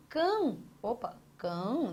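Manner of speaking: "cam-" held long and cut off; first syllable isolated. Shown as a demonstration. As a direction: draw out "cam-" slowly as a lone syllable. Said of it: "separe aí".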